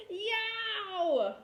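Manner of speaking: high, loud whiny yell; a child wailing loudly at a hurting tooth; yelling in pain. Acted. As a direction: call for Daddy over the hurting tooth. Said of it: "and yelled".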